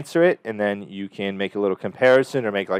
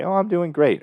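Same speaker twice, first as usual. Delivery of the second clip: cheerful client; 8 semitones higher than usual. Shown as a demonstration. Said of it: "She could say".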